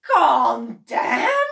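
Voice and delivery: loud, high outraged echo; indignant cry. A narrator's reading. Mister Cranky outraged at his wife, loud. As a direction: throw it back. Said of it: "cried Mister Cranky".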